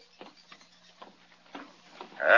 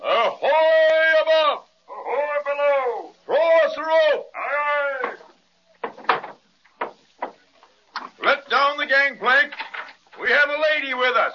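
calling out; 7 semitones higher than usual. Acted. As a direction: call up to the deck. Hailing the ship.

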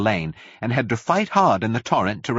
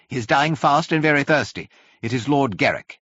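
urgent plea; 6 semitones higher than usual. A narrator's reading. Urgent plea for dying man.

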